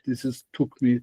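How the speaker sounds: ordinary delivery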